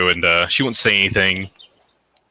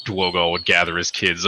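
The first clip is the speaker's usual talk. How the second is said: ordinary talk, nothing marked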